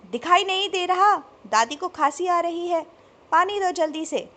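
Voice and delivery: father yelling at son to fetch water, loud; shouting. A narrator's reading. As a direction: bark an urgent order. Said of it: "Ravi एक बार फिर चिल्ला उठे".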